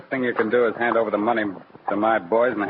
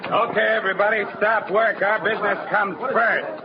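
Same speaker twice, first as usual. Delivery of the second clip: high, barked orders to the whole bank; gangster's holdup command; 10 semitones higher than usual. Acted. Gangster to the bank staff, high barked command. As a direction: take over the room like a gunman.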